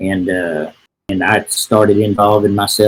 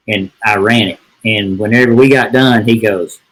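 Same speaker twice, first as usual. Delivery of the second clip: ordinary delivery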